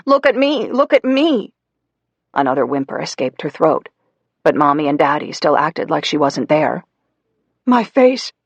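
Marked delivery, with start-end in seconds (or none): 0.1-1.5 put-on high pleading little girl; begging child. Ignored Hannah begging her parents, high pleading. 7.6-8.3 high, louder pained mother's cry; Mommy in pain. Acted. Burned Mommy begging Daddy, high pained cry.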